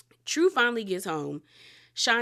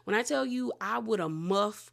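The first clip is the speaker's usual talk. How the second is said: ordinary delivery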